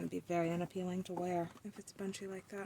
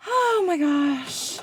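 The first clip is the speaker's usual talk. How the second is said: slow, loud, high exasperated oh my gosh; long exasperated groan of oh my gosh; drawn-out oh my gosh; 7 semitones higher, 15 dB louder, much slower than usual. Real, not acted. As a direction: stretch it out in loud dismay. Exasperated at squeaky machine.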